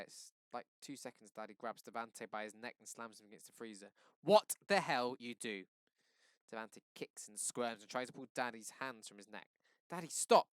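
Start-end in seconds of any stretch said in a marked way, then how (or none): 4.2-5.7 slow, loud, menacing demand; father roaring at the boy he pinned; furious demand. A narrator's reading. Daddy slams Devante against freezer. 9.9-10.5 high, loud plea to stop; panicked shout. A narrator's reading. Daughter begging father to stop.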